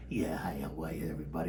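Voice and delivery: put-on low old smoker's voice; Uncle Willie. Uncle greeting the recording room, low smoker's voice. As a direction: greet the room in a deep, subdued mumble. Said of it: "Willie, say hello".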